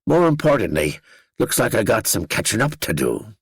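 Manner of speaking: high Northern Irish voice with a smile; Danny, smiling. A narrator's reading. Danny promising the narrator better food up north, smiling Northern Irish accent. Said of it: "His accent thickened".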